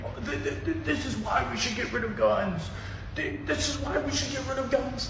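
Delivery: high, stammering parody of the other side; mocking whiny voice. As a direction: sneer it out in a high put-on voice. Mocking gun-control opponents.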